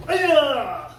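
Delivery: very loud piercing high yell; kiai shout. Shown as a demonstration. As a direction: explode the yell on the break. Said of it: "Break it".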